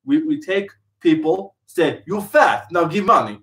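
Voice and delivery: light mock Russian accent; hint of a Russian accent, joking. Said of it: "talking in Russian accent".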